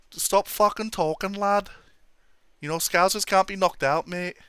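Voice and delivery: high-pitched mock Scouse accent; Paddy Pimblett. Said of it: "whatever he says".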